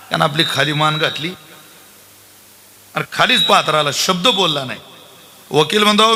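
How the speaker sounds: ordinary talk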